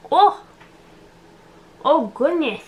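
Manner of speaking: sudden high yelp of surprise; startled. Real, not acted. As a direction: jump at something unexpected.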